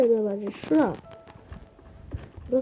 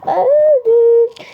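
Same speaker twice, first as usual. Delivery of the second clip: loud cry; 8 semitones higher, 6 dB louder than usual. Real, not acted. Killed in game.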